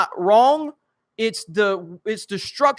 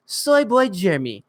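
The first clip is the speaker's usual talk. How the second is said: put-on soy boy voice. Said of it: "I can do Soy Boy Jeremy".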